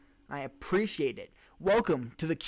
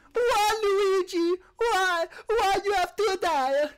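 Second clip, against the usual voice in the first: high, slow, wailing mock Italian lament; bad Italian accent, fake sobbing; horrible Italian accent; 6 dB louder, much slower than usual. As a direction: wail it like a hammed-up Italian mourner, high and drawn out. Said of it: "In a horrible accent".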